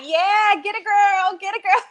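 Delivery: high, loud cheering a friend on; excited hype-girl cheer; hype bestie cheer. Retold or imitated. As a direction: whoop her on like a bestie on the dance floor. Hype bestie cheering on dance floor.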